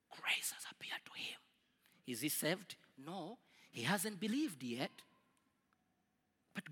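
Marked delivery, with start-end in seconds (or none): 0.1-1.4 breathy whispered hush. Real, not acted. Preacher whispering to the congregation. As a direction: drop to a secret whisper.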